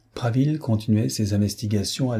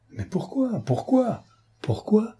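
pourquoi pitched far higher each time, insistent; alarmed repeated question; 12 semitones higher, slower than usual. A narrator's reading. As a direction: press him again and again, alarmed. Clarisse presses Prasville in alarm. (Clarisse supplie Prasville, questions aiguës et pressantes)

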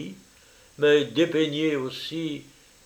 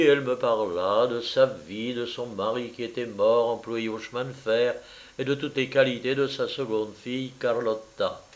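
ordinary delivery, nothing marked

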